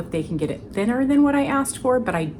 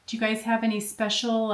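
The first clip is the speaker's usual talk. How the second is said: usual speaking voice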